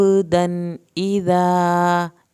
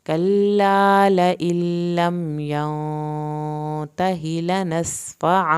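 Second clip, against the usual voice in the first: slow recitation; much slower than usual. Shown as a demonstration. Teacher to students: whole verse in slow tajweed chant. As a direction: intone the verse with long holds.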